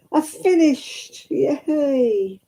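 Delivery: high, drawn-out happy cheer; real delight at being finished; gleeful celebration. Real, not acted. Celebrates finishing a knitting project.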